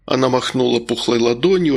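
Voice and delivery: ordinary delivery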